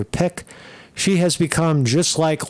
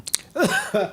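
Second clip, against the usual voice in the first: sharp coughing fit; choking cough; 7 semitones higher, 4 dB quieter than usual. Real, not acted. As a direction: cough hard, something went down the wrong pipe. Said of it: "went down the wrong pipe".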